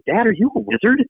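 put-on high child voice asking Dad; kids asking. Kids asking their robed dad, high childlike voice. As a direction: mimic a small kid's wonder. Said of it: "My kids would go".

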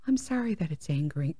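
low, soft mocking imitation; mock apology. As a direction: parrot her sorry in a quiet put-on voice.

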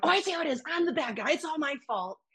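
high, soft mocking martyr voice; mock self-pity. Boyfriend cutting off her complaint, mock wounded. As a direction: imitate a sulky put-upon partner, high and quiet. Retold partner playing the wronged one.

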